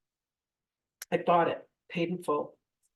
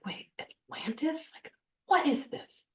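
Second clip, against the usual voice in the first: high, slow, half-whispered disbelief; drawn-out, high-pitched mock bewilderment; freaked out; 11 semitones higher than usual. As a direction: re-enact bewildered panic, rising into a squeak. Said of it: "I was completely freaked out".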